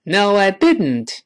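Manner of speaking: No, I didn't said slowly with the t in didn't released through the nose; slow, deliberate No, I didn't; slow careful read. Shown as a demonstration. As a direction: drill it slowly, nasal plosion on didn't. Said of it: "注意这个鼻腔爆破".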